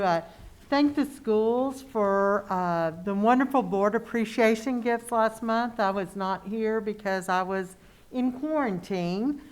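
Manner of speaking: ordinary talk, nothing marked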